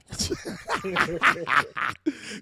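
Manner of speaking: wheezing laugh up in the head voice; cracking up at his own joke; high breathless laugh. Real, not acted. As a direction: break into a silent high cackle.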